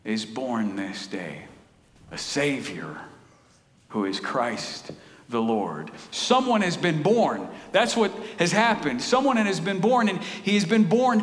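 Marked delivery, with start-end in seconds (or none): 0.0-5.9 low, slow solemn recitation; hushed reverent reading of the verse; scripture reading. Acted. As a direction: let each phrase land with weight. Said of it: "he says".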